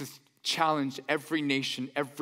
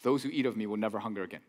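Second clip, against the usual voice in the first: lower, softer conversational delivery; Jesus, real calm; 6 semitones lower, 4 dB quieter, faster than usual. Acted. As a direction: drop it casually into a conversation. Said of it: "just, just real calm".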